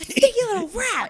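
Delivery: very high mimicked squeal; squeaky, playful mock outburst; crazy sounds impression. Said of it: "makes all of those crazy sounds".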